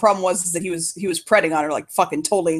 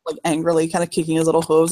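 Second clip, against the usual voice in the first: ordinary talk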